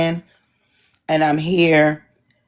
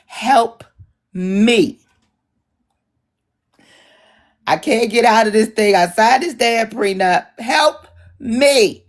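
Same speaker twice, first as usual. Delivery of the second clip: higher, lightly voiced desperate plea; mock Melania plea; 6 semitones higher than usual. Voicing Melania begging to escape her prenup. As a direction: ham up a trapped wife begging. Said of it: "Melania was blinking twice".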